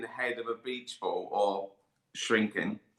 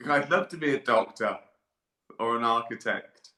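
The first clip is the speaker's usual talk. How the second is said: ordinary delivery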